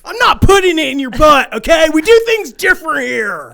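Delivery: high, loud hammed-up shout; mock brash American shout. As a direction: yell it like a cartoon brash American. Mock American arguing with girlfriend.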